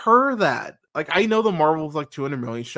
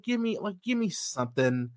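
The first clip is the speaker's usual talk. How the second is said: ordinary delivery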